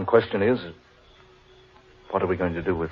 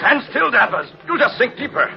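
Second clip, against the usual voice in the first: much higher, loud urgent call; urgent shout; 4 dB louder than usual. Acted. Man shouting to sinking Danvers to stand still, urgent. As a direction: yell orders over the panic.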